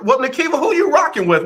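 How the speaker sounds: high, playful call to a listener; teasing call-out. Real, not acted. Radio host teasing a caller, high playful call-out. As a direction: pitch it up and tease her.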